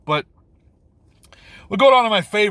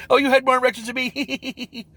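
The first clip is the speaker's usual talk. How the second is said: mock giggle, then a teasing high line; hammed-up schoolboy giggle; giggling mockery; 9 semitones higher than usual. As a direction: imitate the actors giggling like kids. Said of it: "And they're laughing and giggling".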